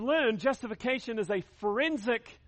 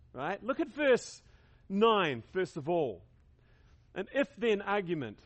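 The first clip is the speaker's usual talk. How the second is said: ordinary talk, nothing marked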